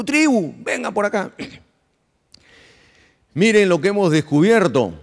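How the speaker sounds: high, summoning call to the crowd; the chief calling the tribe to gather. Acted. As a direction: call the whole tribe over.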